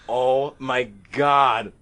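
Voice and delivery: very slow, stretched-out exclamation; drawn-out disbelief. Retold or imitated. Storyteller reliving the spill, slow stunned exclamation. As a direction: drag out each word in retold dismay. Reacts to being drenched in story.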